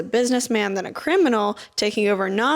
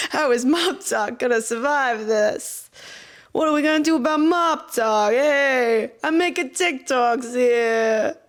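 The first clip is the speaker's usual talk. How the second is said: put-on heavy New York accent; 4 semitones higher than usual. Pretending to be a TikTok mobster in a heavy New York accent. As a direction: lean into a cartoon New York wise guy accent. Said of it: "really heavy New York accent and being like".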